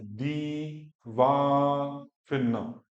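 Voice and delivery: very slow, "दी" and "वा" each held long, "न" short; syllable-by-syllable. Shown as a demonstration. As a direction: stretch "दी", stretch "वा", then a brief "न". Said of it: "दो second का time देना है".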